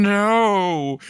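high, drawn-out refusal; hammed-up yelled retort; mock scream back. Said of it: "she screams back at him".

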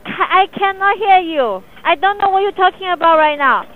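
ordinary talk

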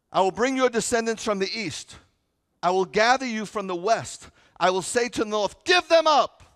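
high, loud thundering scripture; booming the verse, denying it's yelling; proclaimed shout. Acted. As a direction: shout it like God commanding. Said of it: "I'm not yelling".

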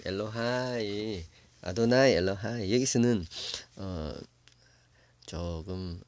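normal talking voice

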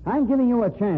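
forceful push. Acted. Blackmailer pressures the editor.

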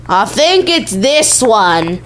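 slow, exaggerated "I think it's this one"; drawn-out. Player choosing which one it is, drawn-out delivery. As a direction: stretch out "this one" with slow, hammy weight.

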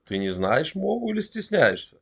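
ordinary talk

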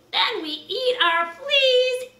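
high, slow silly line; comic high punchline. A narrator's reading. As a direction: land the joke up high.

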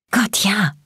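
high, panted assent; gasped. A narrator's reading. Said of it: "keuchte ich".

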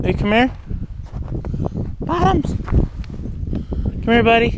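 high-pitched pet-calling voice; coaxing an animal. Real, not acted.

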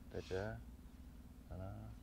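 normal talking voice

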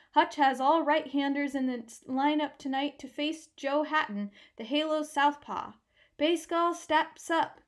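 loud, nasal play-by-play voice; radio announcer. Acted. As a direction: call the lineup like a radio announcer. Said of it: "Huey paused in the doorway to listen".

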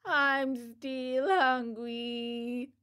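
put-on very slow, drawn-out childish whine; yawning wail. A narrator's reading. Pinocchio complaining after devouring pears, long yawning wail. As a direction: wail it through a yawn, stretching every word. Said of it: "he yawned deeply and wailed".

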